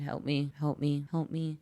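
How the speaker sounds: low, quiet, monotone voice; monotone pleading. Acted. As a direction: say it softly and low, with no urgency. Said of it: "in a monotone voice saying".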